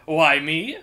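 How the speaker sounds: high, loud, grand self-introduction; proud minstrel. Acted. Minstrel introducing himself to the thief, loud and proud. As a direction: announce yourself like a proud bard.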